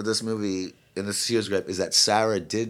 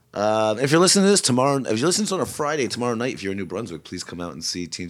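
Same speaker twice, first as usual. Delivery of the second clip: normal talking voice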